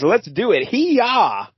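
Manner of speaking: rally the riders with a playful high yell; mock battle cry. Voicing Questar's battle cry.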